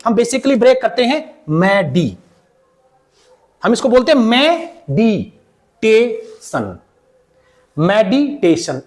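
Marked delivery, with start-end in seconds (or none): 1.5-2.2 मैडी split as mae-dee; wrong syllable break. Shown as a demonstration. Teacher showing how we break it, मैडी as mae-dee. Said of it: "इसमें जो हम break करते हैं". 4.9-8.8 मेडिटेशन slowly split as me-dee-tay-shun; wrong syllable break. Shown as a demonstration. Teacher imitating the common error, slow me-dee-tay-shun मेडिटेशन. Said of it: "It is wrong".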